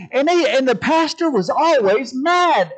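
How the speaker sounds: imitating a comedian's high, loud delivery; comedian bit. Preacher quoting comedian on the mad pastor, exclaiming. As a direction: punch it out like a stand-up complaint. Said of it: "the comedian I was watching".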